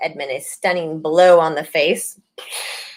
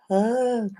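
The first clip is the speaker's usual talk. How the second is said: sudden high, soft startled ah; deflated realisation; caught-out ah; 6 semitones higher, 5 dB quieter than usual. Pretend. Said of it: "he sees the witch and he's like".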